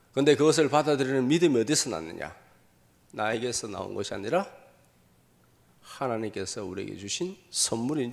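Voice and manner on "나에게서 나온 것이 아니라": ordinary talk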